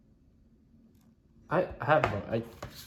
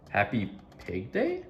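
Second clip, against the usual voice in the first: confused; 3 semitones lower, 6 dB quieter than usual. Acted. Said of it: "Gerald's confused".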